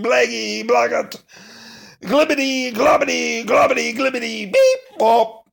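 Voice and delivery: loud, high silly babble; silly nonsense voice. Acted. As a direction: go wild with nonsense. Reads nonsense sounds.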